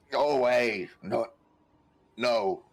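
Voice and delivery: a groggy, put-on cry to be left alone; groggy protest. Wounded man roused by prodding, groggily protesting. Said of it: "sort of groggily start to awake".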